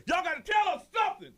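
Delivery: high, loud furious yell at staff; angry passenger shout. Retold or imitated. Angry passenger yelling at gate agents, loud demand. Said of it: "Somebody screamed".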